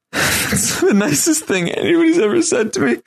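hammed-up high, squeaky blubbering; mock crying. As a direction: wail it out as an exaggerated tearful imitation. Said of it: "is, like, sniffling. He's, like, crying".

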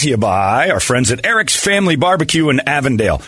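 upbeat sponsor read. Acted. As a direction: announce the sponsor brightly. Podcast outro and sponsor read.

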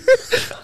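airy high giggle; high breathy laugh. Real, not acted. Laughs at own joke.

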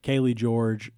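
ordinary delivery

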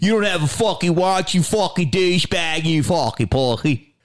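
put-on high, riled-up Philly Delco accent; hammed-up Philly street abuse in Delco accent. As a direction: heckle in a thick Delco accent. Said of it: "fucking Delco accent".

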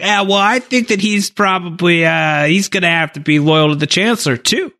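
high, hedging mock excuse; evasive. Anakin dodging Padme's question, high evasive voice. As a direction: squirm through it like a caught liar.

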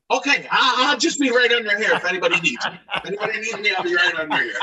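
high, sheepish retreat; a lightly mocked, sheepish apology to the director. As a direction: back off embarrassed, laughing. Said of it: "I go like this".

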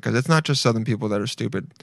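usual speaking voice

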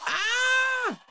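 high yelp; mock scream. Explosion skit scream.

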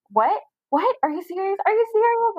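retold incredulous reaction, pitch soaring; astonished disbelief. Husband's disbelief at pregnancy news, retold.

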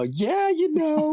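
high, slow, sing-song mock take; mocking high-pitched version. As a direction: camp up how he supposedly said it. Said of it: "I didn't go".

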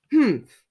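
high, loud disgruntled grunt; grumpy humph. A narrator's reading. Mister Grumpy sees nobody there, loud grunt. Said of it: "grunted Mister Grumpy".

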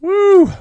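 high, loud "woo"; celebratory whoop. Real, not acted. Host cheering the winning contestant, high whoop.

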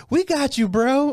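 high cheering encouragement; supportive fan voice. Retold or imitated. Lone friendly table cheering comic, high. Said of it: "one table that's like".

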